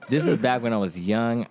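ordinary talk

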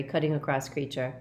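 ordinary delivery, nothing marked

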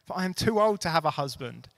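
Naomi's plea read from the pulpit, swelling then falling; impassioned scripture reading. Acted. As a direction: build the plea, then let it sink. Reading scripture aloud, building.

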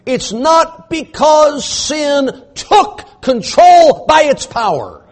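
slow, high, loud preaching; emphatic pulpit delivery; preaching at full force. Acted. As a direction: drive each word home slowly. Preacher hammering the point.

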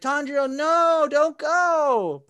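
little brother begging Tanjiro not to go; pleading cry. Retold or imitated. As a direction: beg your big brother not to leave. Little brother begs Tanjiro to stay.

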